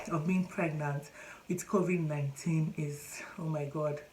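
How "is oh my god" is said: breathy whispered dismay, under the breath; exasperated whisper. Retold or imitated. Complaining to viewers about masks, whispered dismay. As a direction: whisper it like a groan of dread. Dreading face masks everywhere.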